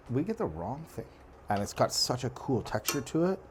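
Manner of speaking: usual speaking voice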